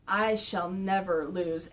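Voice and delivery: low, slowing villain; Shredder. Retold or imitated. Shredder defying the turtles, low slow villain vow. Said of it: "he goes like".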